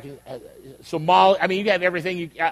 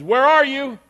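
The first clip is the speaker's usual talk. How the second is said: raised, loud searching call; 7 semitones higher, 5 dB louder, slower than usual. Acted. God calling to Adam in the garden, calling out. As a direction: call across the garden, pitched high.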